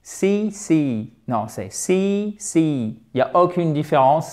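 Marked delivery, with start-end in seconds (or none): 1.7-3.0 see said slowly with a long ee; slow model of see with a long i; long i demo. Shown as a demonstration. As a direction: draw out the ee in see. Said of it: "c'est un I long".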